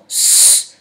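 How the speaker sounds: S as a long "sss"; isolated sound. Shown as a demonstration. Teacher explaining weak vowels after C, S held as "sss". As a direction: hiss S alone, drawn out. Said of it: "Pour faire le son S".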